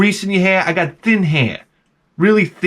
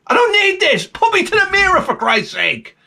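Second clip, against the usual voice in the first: exasperated. Acted. Exasperated rant at barber.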